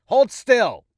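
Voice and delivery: sharp high shout; shouted order. Acted. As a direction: bark the order loudly. Patient's chant, shouting to hold still.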